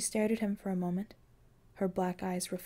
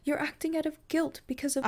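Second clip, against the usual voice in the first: angry edge; 7 semitones higher than usual. A narrator's reading. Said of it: "an angry edge to her voice".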